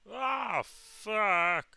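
high, loud falsetto howl; mock mom yell. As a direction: howl the curse like mom outside. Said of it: "my mom from the porch yell".

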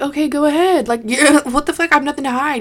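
retold defiance, pitched high; defiant comeback. Defiant reply to cop, retold.

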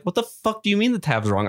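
fast, high mock outrage; indignant husband. Guitarist to wife over a wrong tab, mock indignant. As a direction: pitch it up, rattle off a bantering comeback. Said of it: "And he's like".

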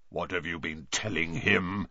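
low suspicious threat; growl. A narrator's reading. Bane questions Firenze suspiciously, low growl. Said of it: "growled Bane".

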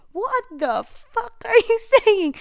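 high, loud and slow, imitating her baffled angry dad; mock outrage at his drunk daughter's gibberish; drunk-dad rant. Dad yelling at his drunk daughter, hammed-up high and loud.

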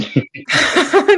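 big yawn into the microphone. Real, not acted. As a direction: yawn with a little laugh. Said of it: "just rudely yawn into the microphone".

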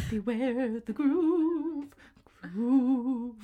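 slow, eerie drawn-out mock warning; old man's warning. Old man climbing on Pacha, slow trailing warning. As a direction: stretch the vowels and let them trail away.

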